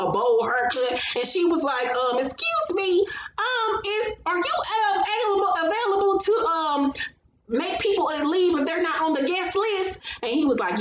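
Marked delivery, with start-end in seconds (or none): 2.2-10.0 high, hesitant mock-polite voice; put-on high voice asking a favour; polite request, imitated. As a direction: ask sweetly up high with halting ums. Voices Melody asking the guard.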